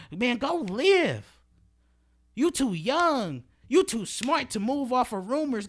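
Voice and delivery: slow, loud, high plea to go live; emphatic urging. Real, not acted. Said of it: "emphatic urging".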